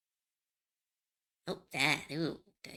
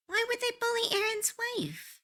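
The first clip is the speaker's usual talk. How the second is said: high, disbelieving question; shocked and baffled; surprised. Real, not acted. Shocked at chat news.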